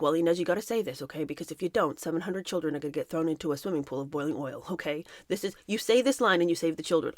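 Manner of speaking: low, softened mock-reasonable parent voice; father explaining gravely. Father coaxing daughter to say a line, low hushed explaining. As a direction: lay out absurd stakes quietly to a child.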